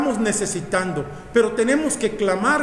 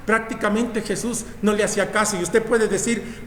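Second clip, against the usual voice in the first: ordinary delivery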